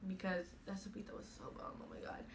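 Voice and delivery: whispered, savoring aside; hushed aside. Real, not acted. Telling viewers about boyfriend's breakfast, whispered rave. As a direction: drop to a whisper, as if confiding how good it was.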